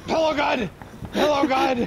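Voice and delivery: loud high callout; excited shouted callout. Real, not acted. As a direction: yell it out to the squad. Calling out weapon in game.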